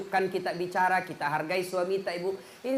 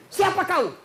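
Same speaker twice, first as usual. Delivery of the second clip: high, louder startled cry; startled husband; 9 semitones higher, 5 dB louder than usual. Retold or imitated. Startled husband asking the creature, high and loud. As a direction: jump back and demand who it is.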